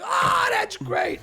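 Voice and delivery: loud, high, drawn-out mock rave; mock delight. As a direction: exclaim it slowly and loudly, imitating huge approval. Acts out delighted reaction to a joke.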